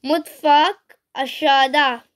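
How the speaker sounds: usual speaking voice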